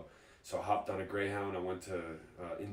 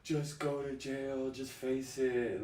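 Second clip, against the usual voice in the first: slow, higher mocking voice; mocking weak relatives; 5 semitones higher, much slower than usual. Imitating relatives telling him to give up. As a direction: drag it out like a whiny relative. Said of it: "trying to give me all this weak talk".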